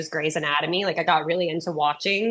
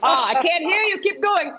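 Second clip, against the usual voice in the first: much higher, playful hype over her own laugh; egging the crowd on; 10 semitones higher than usual. Real, not acted. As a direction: push the audience to laugh harder. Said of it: "Let's all laugh".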